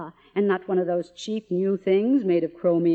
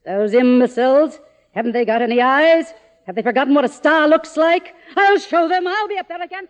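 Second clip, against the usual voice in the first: rising louder and higher, a furious tirade; furious rant; 7 semitones higher, 7 dB louder, faster than usual. Acted. Faded star raging at the producers who forgot her, furious and loud.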